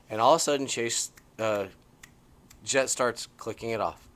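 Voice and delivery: ordinary talk, nothing marked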